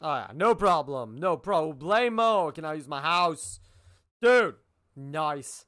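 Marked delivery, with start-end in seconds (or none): none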